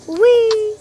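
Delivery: high loud playful squeal; gleeful wee. Retold or imitated. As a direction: squeal it like a kid on a slide. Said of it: "I'm like".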